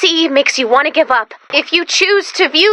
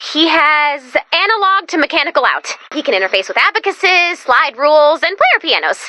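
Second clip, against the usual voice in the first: ordinary talk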